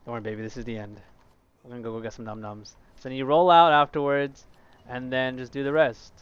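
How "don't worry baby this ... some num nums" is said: cooing. Real, not acted. Cooing to the game character.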